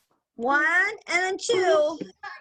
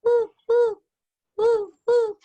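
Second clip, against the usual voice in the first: high, loud owl hooting; 5 semitones higher, 5 dB louder than usual. Shown as a demonstration. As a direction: hoot like an owl. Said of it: "You can hear the owls".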